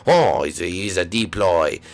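very low, slow monster voice; deep villain voice. Acted. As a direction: growl it out like a game boss. Deep villain voice in game play.

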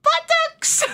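mock coy giggle voice; cheeky squeak. Real, not acted. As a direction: say it high and silly. Cheeky joke about figure.

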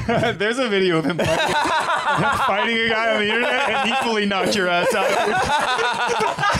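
loud, giggling through it; loud laughing tease about the fight video; laughing roast. Real, not acted. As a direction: crack up while teasing him. Roasting friend while laughing.

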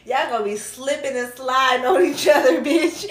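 loud, high laugh through the words; cackling tease. Real, not acted. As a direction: crack up while saying it. Cracking up over the reading.

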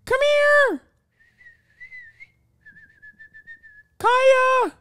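piercingly high, loud dog call; puppy voice. Real, not acted. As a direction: call the dog in an excited falsetto. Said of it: "your puppy voice?".

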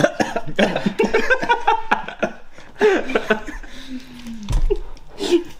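high laugh. Real, not acted. Laughs at own excuse.

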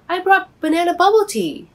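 high, loud, bright offer of a treat; eager offer. Acted. Bobby eagerly offers his friend tea, high and loud. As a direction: pitch it up and call out eagerly.

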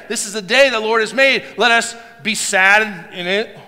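loud, high deliberate misquote of the verse; mock misquote. Shown as a demonstration. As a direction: shout the wrong version on purpose. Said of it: "No, let's rejoice".

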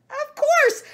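squeaky high monkey. Acted. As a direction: pitch it way up like a monkey. Monkey speaks, pitched up.

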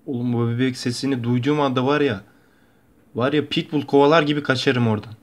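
ordinary talk

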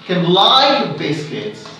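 slow, with the weight on "like"; stress on like. Shown as a demonstration. Teacher modelling emphatic stress for students, "like" stressed. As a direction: lean hard into "like" and let "biscuits" fall away. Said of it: "the emphasized word here is like".

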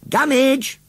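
high, loud call of a name. A narrator's reading. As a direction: call to get his attention. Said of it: "called John".

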